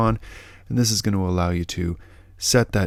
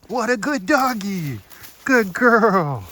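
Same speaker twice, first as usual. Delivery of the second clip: high dog praise; 13 semitones higher, 5 dB louder, slower than usual. Real, not acted. Praising the dog after her find, in squeaky baby talk. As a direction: gush over the good dog.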